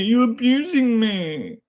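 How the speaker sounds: slow, high drawn-out whine; whiny mock victim. Streamer parodying a chatter's complaint, slow high whine. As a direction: stretch it out like a mock crybaby.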